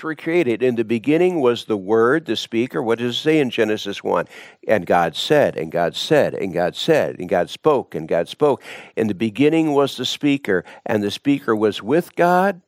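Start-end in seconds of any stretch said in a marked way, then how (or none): 4.7-8.6 low solemn refrain. Real, not acted.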